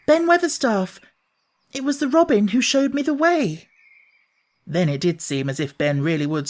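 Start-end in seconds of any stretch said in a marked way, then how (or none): 0.1-3.7 put-on high, raised girl's voice calling upward; girl calling up, gasping. Mary calling up to the gardener, high and breathless. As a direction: call up to him with a catch of breath. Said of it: "called up to him with a sort of gasp".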